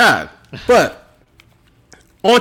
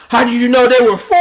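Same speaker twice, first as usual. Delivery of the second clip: indignant yell; 9 semitones higher, 5 dB louder than usual. Retold or imitated. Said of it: "Bad's like".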